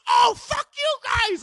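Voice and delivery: high, loud, drawn-out mock shout; left-behind furious yell. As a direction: holler after a departing car, imitating him. Friend left behind as car leaves.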